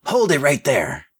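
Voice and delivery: high-pitched mock shout. A narrator's reading. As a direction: order a suspect to stop. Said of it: "she shouted".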